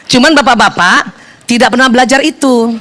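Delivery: ordinary talk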